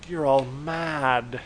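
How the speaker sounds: very slow, ominous delivery; slow, mock-grave "You're all mad"; dramatic slow line. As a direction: draw it out like a stage accusation. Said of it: "John Lovitz goes".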